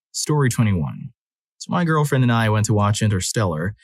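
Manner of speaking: ordinary delivery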